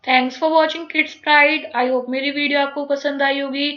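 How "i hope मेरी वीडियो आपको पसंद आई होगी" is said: ordinary talk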